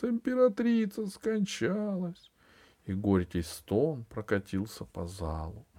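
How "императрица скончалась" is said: high, grieving cry; mourning announcement. A narrator's reading. A voice telling the hall she died, grieving. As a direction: announce the death in sorrow. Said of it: "послышался чей-то голос".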